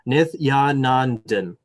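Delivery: Nithyanandan slowly as "Nith-ya-nan-dan"; careful pronunciation. Real, not acted. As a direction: take Nithyanandan slowly so the tongue doesn't trip. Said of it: "it's just when I go faster".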